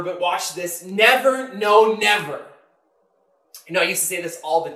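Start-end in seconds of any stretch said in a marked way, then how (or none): 0.8-2.4 "never" raised and hit hard, then "no, never"; loud, high, insistent "never, no, never"; emphatic never. Retold or imitated. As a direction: swear it with force on each "never". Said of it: "It's an emphatic never".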